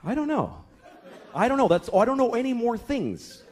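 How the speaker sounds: high, helpless admission; flustered father. Acted. Father out of answers for his daughter, flustered. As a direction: plead that you have nothing left.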